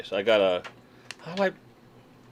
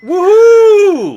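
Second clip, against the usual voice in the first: loud, high whoop of joy; excited cheer; 16 dB louder than usual. Real, not acted. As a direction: cheer the donation. Donation alert celebration.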